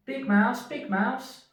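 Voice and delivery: high, small mouse voice calling out; a tiny mouse calling to his big friend; Little Mouse. Acted. Little Mouse calls anxiously to Big Mouse: high squeaky call.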